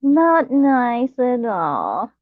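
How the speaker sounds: playful scolding, stretched slow; mock hurt. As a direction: pile on the fake offence. Mock hurt reply to chat.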